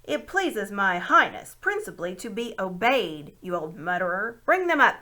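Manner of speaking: put-on loud, haughty command to the old gnome; imperious call. A narrator's reading. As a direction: call it out, brooking no delay. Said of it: "it called".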